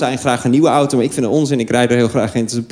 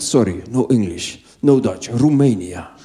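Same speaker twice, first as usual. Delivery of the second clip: put-on low, slow Romanian-accented man; broken English, low and slow; imitating a low Romanian accent; 7 semitones lower than usual. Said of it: "hij kijkt naar mij en hij zegt".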